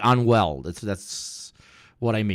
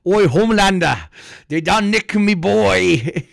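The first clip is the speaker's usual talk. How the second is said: mock Australian accent; 7 semitones higher, 5 dB louder than usual. Reacting to a clip, hammed-up Australian accent. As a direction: ham up an Australian accent, shouting. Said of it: "I can't do the Australian accent".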